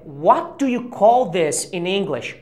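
slow, careful teaching read of call; slow demo. Shown as a demonstration. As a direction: spell it out slowly, stressing "call". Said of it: "a frase correta é". (leitura lenta e didática)

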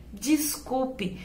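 soft, tearful apology; crying apology. A narrator's reading. Tico apologizes to mom, softly tearful. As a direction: say sorry quietly, almost in tears. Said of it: "disse Tico chorando".

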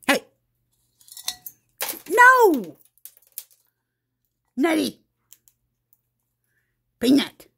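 loud, high yelling at the pet; loud scolding yell. Real, not acted. Mom yelling at Peanut for throwing food, high and loud.

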